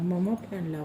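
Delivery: put-on feed the toddler the words gently; coaxing prompt. Shown as a demonstration. Mother prompts toddler what to say to mummy, softly coaxing. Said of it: "म्हणा".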